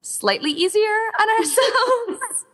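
said through a high laugh; amused, pitch jumping up. Real, not acted. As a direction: laugh while finishing the thought. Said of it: "laugh on the line".

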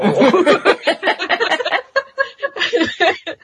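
long, high real laugh; burst of laughter. Real, not acted. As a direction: break into helpless laughter at the memory. Delighted by a funny podfic.

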